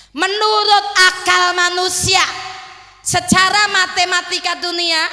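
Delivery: loud, high, slow preaching climax; fiery preaching. Acted. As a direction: preach it out to the crowd. (khotbah berapi-api, keras dan lambat)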